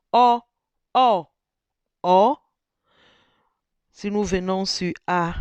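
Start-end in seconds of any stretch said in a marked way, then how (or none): 0.1-2.4 "O" said loud on different tones, one after another; tone demo on O. Shown as a demonstration. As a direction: call out "O" on each tone in turn. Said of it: "ici, c'est O".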